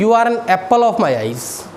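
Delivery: slow, careful correct phrasing; slow correct version. Shown as a demonstration. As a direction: model the right English slowly. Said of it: "वो बोलते हैं". (सही अंग्रेज़ी धीरे-धीरे बोलते हुए)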